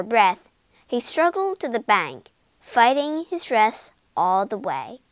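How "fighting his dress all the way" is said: usual speaking voice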